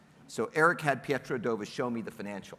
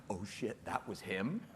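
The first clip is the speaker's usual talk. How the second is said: hushed realization; 7 semitones lower, 11 dB quieter, slower than usual. Retold or imitated. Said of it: "And, like".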